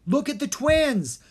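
urgent yell mid-struggle. A narrator's reading. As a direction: yell while wrestling the wizard. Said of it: "Dharma yelled".

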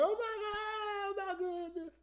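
hammed-up high, drawn-out squeal of shock. Preacher imitating a sister surprised on her birthday, shocked squeal. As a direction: imitate an overwhelmed woman, slow and very high. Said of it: "She'd have been shocked".

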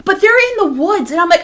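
ordinary delivery

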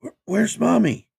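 put-on high, loud scared little girl; small frightened child. A narrator's reading. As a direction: stammer it like a terrified child. Child asks after smoke.

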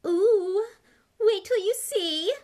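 high, loud, drawn-out relish; gleeful. A narrator's reading. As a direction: revel in someone else's misfortune. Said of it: "never seen her looking so happy".

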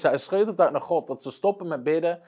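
usual speaking voice

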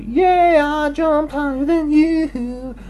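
high, bragging little voice; gloating grasshopper. Acted. Gary the grasshopper brags to Frank in a high gloating voice. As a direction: crow about it like a cocky bug.

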